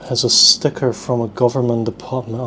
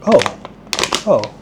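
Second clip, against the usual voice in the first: a sudden high, loud "oh, oh"; surprised oh; 4 semitones higher, 4 dB louder than usual. Real, not acted. Opening the snack pack, surprised by what's inside. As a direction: caught off guard, voice jumping up.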